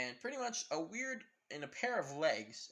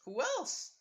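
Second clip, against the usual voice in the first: much higher and a touch louder, clingy; possessive Karin; 4 dB louder than usual. Retold or imitated. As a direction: claim him while hugging his arm. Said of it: "Karin ... saying".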